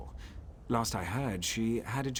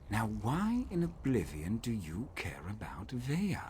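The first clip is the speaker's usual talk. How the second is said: whisper; 3 dB quieter than usual. Acted. Suspicious hushed question.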